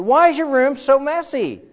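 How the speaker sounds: high, loud exasperated nag; nagging mom. Retold or imitated. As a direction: scold like a fed-up mom. Mom nagging the neat kid.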